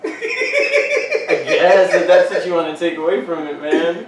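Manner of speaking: laughing through the words. Real, not acted. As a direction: giggle it out, amused. Laughing at friend's joke.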